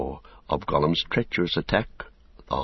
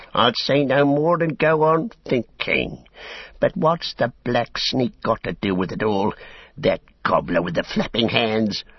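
higher-pitched, grudging orc; sullen orc tracker; 6 semitones higher than usual. A narrator's reading. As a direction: back down, then probe grudgingly. Narrator plays the tracker.

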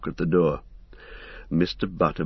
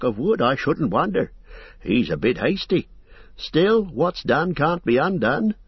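fussy innkeeper; 7 semitones higher than usual. Acted. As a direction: chatter on like a busy, apologetic landlord. Landlord voiced in the story.

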